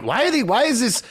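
high-pitched incredulous questioning; mock bafflement. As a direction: imitate a baffled viewer, pitch jumping up. Host mimics baffled viewers' reaction.

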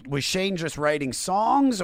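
ordinary talk, nothing marked

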